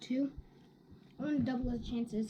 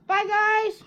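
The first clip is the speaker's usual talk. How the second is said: loud goodbye; 6 semitones higher, 9 dB louder than usual. Real, not acted. Son shouts outro goodbye.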